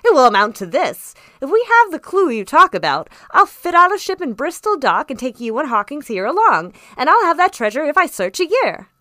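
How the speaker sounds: loud, high, vehement declaration; fired-up, emphatic boasting; excited squire's cry. A narrator's reading. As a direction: proclaim a grand plan with full bluster. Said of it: "cried the squire".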